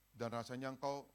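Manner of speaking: usual speaking voice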